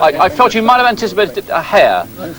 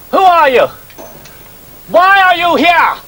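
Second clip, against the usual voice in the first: raised, sharp interrogating voice; commanding officer; 9 semitones higher, 3 dB louder, slower than usual. Acted. Officer challenging an intruder on set, sharp and raised. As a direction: demand it of the intruder. Soldier questions intruder on take.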